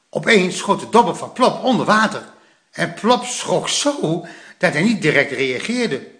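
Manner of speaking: ordinary delivery, nothing marked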